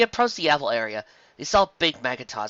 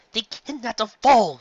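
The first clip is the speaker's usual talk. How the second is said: indignant; 10 semitones higher, 6 dB louder, slower than usual. Acted. Carrot Top indignant arguing with Trixie.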